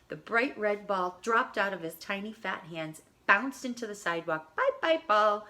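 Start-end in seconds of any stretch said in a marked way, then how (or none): 4.5-5.5 put-on baby scream. A narrator's reading. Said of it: "baby Joshua screamed".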